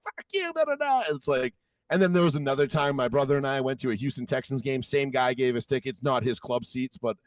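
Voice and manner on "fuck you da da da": very high, babbling mock mother; mother's rant mimicked as shrill gibberish. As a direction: squeak out a nonsense insult. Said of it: "then the mother's like".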